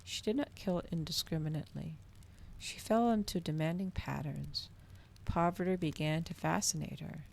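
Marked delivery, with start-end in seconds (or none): none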